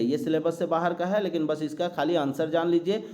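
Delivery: normal talking voice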